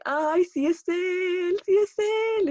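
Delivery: rising to a high, loud, slow excited squeal. Retold or imitated. Fan recognizing her idol, squealing with excitement. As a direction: barely hold in the fangirl scream. Spotting the guitarist, nervous fan.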